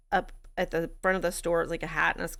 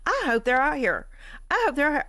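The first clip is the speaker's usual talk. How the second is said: defiant widow rage; 7 semitones higher, 4 dB louder, faster than usual. Retold or imitated. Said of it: "that weird, fucked up widow part of me".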